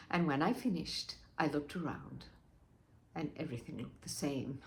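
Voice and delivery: low, very soft letdown; deflated. Acted. Wife finds all her cleaning changed nothing, deflated. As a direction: let the voice sink away, defeated.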